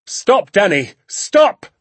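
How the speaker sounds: high, loud cry to halt; urgent warning shout. A narrator's reading. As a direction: shout to stop your son in his tracks. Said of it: "he shouted".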